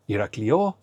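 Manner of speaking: "Iraklio" stressed on the final O; wrong stress demo. Shown as a demonstration. Teacher giving another wrong example, stress on the end of "Iraklio". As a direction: push the stress to the last O of "Iraklio". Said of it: "and not, for example".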